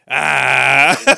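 a mock startled yelp; startled yell. Retelling to friends, the soldier finds him under the tarp, mock yelp. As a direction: jump out with a short cry.

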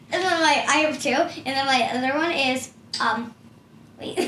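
ordinary talk

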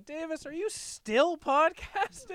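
high, drawn-out mock mom call; slow it down and pitch it up like a nagging mom; imitated mom. Host plays co-host's mom opening the episode, high mock-mom voice. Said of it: "I wanted to ask Davis's mom".

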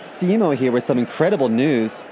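bubbly high influencer vlog voice; chirpy sponsored-video host. Pretend. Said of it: "something like this".

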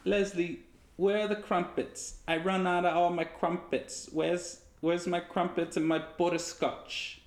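high-pitched mock British voice; British accent. Asking Leslie for crumpets in play, high put-on British accent. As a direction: ham it up as a high-voiced Brit. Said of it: "was a British accent".

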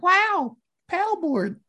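very high, oblivious awe; mock wonder. Reacting to summer camp unlike Katie, mock wonder. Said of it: "I'm just like".

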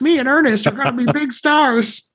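put-on high, eager little kid voice; excited kid voice. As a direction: gush like a starstruck kid. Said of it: "you're just like".

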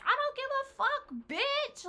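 very high, loud re-enacted yell; screamed comeback to the ex; retold scream. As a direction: yell it high, reliving the fight. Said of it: "screaming at him".

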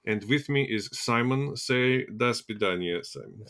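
pantomime Russian KGB host; terrible Russian accent. Pretend. Host introducing his co-host, mock Russian accent. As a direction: announce it in a hammy Russian accent. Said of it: "my terrible Russian accent".

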